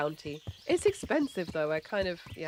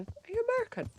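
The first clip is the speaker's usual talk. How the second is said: high, curious asking voice; curious question; faster than usual. Retold or imitated. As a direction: voice the parcel guy lightly. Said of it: "parcel guy".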